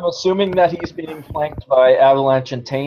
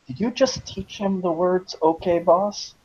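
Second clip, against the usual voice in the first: softer, slightly higher hushed question; whisper to him; 4 semitones higher, 5 dB quieter, slower than usual. Acted. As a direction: ask it as a discreet aside. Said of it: "whisper to him".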